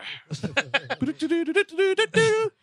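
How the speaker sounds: high, rising sung jingle; wordless rising musical ditty; imitated game music. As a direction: mimic a frantic video game tune. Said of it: "The same music comes up".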